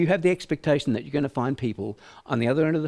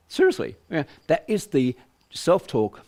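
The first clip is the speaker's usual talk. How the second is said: ordinary talk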